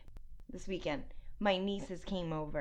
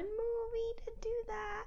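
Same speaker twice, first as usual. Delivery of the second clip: playful high voice; 14 semitones higher, 7 dB quieter, slower than usual. Acted.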